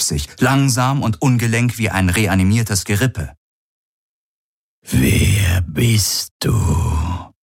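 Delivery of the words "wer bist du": very slow, drawn-out ghostly murmur; weak murmuring voice. A narrator's reading. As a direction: stretch each word like a confused telepathic being. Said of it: "eine Stimme durch seinen Verstand raunen. Sie klang schwach und verwirrt". (schwach und verwirrt geraunt)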